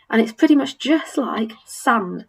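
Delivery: normal talking voice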